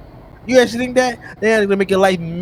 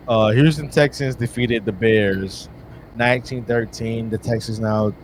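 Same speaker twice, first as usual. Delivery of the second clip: sports anchor read; 8 semitones lower, 4 dB quieter than usual. Acted. Mock sports host reads scores.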